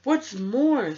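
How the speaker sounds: a sharp, irritated snap; snapped. A narrator's reading. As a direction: bite off the words, temper rising. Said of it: "snapped the Lorax, his dander was up".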